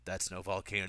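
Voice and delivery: low, hushed movie-character imitation; soft, ominous mock line; Drinian. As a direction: say it low and knowing, lightly acted. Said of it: "it's more interesting the way he goes".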